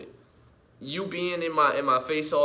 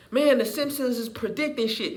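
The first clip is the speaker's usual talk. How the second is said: mocking voice; 8 semitones higher than usual. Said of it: "I hate when people say".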